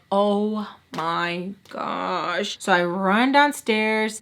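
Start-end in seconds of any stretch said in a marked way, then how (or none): 0.1-2.6 slow, hushed oh my gosh drawn out; drawn-out gasp. Retold or imitated. Hearing a vase break downstairs, slow hushed dread. Hears something break upstairs.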